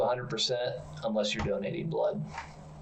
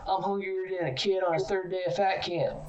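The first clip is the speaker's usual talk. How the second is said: mock a skinny Southern inmate in a Southern accent; 9 semitones higher than usual. Retelling a lunchroom fight, skinny inmate's line in a high mock Southern accent. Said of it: "in a southern accent".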